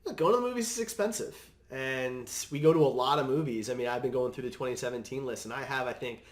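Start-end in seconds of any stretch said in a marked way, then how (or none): none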